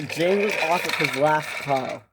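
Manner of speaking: ordinary talk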